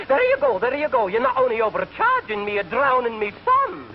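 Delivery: very high, wailing Scotsman; horrified Scottish accent. Acted. Scotsman losing his suitcase, high horrified Scottish accent.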